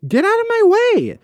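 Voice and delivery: high, fast, fed-up shriek of "get out of my way"; exasperated yell. Pretend. Annoyed at slow shoppers.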